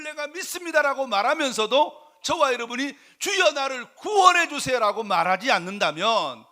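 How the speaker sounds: loud, high, impassioned preaching; full-force preaching. Acted. Preacher urging the congregation to ask for salvation, full force. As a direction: preach it out at the top of the voice.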